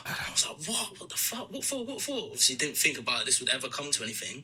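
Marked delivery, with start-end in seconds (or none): 0.7-2.2 high-pitched, hushed mock panic; retold panic. Stepson hearing police are waiting, retold panic. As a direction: squeak out disbelieving questions, imitating your panicked self.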